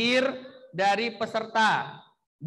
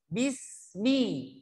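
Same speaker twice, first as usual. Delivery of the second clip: normal talking voice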